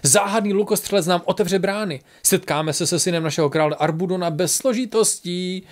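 high, amused, excited announcement; pobavené zvolání. A narrator's reading. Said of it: "křikl pobaveně Bík". (pobaveně a zvýšeným hlasem)

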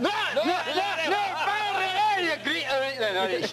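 high, laughing stream of broad Scots; thick Scottish accent. Acted. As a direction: rattle off Scots too thick to follow. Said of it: "need subtitles to understand".

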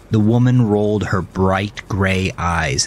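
ordinary delivery, nothing marked

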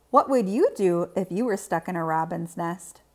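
ordinary delivery, nothing marked

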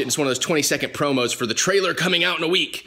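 ordinary delivery

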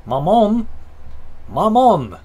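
Maman called out loud and high, mock French vowels. Shown as a demonstration. Streamer showing off his French, called out loud. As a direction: belt Maman like a French child calling. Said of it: "That's how you say mother in in French".

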